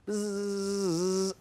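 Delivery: long, steady voiced buzz; imitated buzz. Shown as a demonstration. Teacher buzzing round the board in a letter game, steady buzz. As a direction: buzz like a bee on one note.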